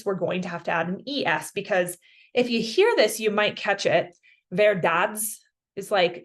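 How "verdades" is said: "Verdades" said slowly, flat and choppy, American vowels; flat American accent. Shown as a demonstration. Teacher showing the wrong American way, flat and choppy. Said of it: "it just sounds very flat and choppy and American".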